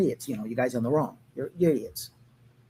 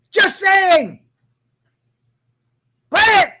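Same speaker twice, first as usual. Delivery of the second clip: shouted, high and loud; 11 semitones higher, 9 dB louder, slower than usual. Real, not acted. Urging every ice officer to play his song, shouted.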